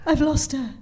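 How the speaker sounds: high, breathless panic on the phone; husband calling in a panic; panicked phone call. Retold or imitated. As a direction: gasp it out in a panic. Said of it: "I get a phone call going".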